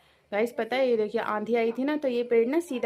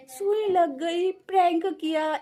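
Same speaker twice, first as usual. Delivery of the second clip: high, slow drawn-out complaint; indignant retelling; 7 semitones higher, slower than usual. Real, not acted. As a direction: lay out the prank to mom, outraged. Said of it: "बताओ mummy".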